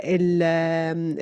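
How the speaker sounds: normal talking voice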